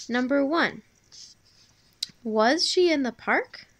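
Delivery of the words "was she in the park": higher, louder question rising on "park". Shown as a demonstration. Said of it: "your voice would go up at the end".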